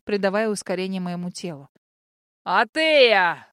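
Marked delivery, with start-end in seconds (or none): 2.4-3.5 loud, long furious bellow; enraged yell. A narrator's reading. Enraged mage atop the cliff, furious yell. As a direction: bellow the name in fury. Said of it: "орал взбешённый маг".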